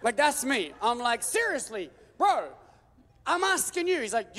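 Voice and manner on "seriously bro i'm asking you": exasperated plea. Retold or imitated. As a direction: pester God like a buddy. Pleading exasperated with God.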